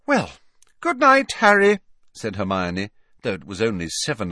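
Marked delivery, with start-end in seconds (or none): 0.0-1.8 higher, louder goodnight; Hermione's abrupt goodnight. Acted. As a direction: cut the evening short with a high, raised voice. Hermione takes abrupt leave.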